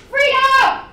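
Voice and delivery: high, full-voiced shout; rallying cry. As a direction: shout it like a protest slogan. Poem echoes descendants' cry.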